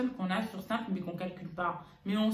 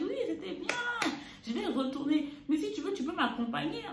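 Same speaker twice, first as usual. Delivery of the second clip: mother's voice, pitched up high; imitating mother; 8 semitones higher than usual. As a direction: put on her mom's way of talking. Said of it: "d'imiter ma mère, comment elle parle".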